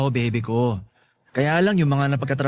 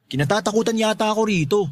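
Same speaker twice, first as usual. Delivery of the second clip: ordinary talk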